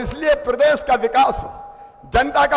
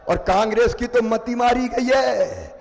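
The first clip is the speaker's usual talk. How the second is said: ordinary talk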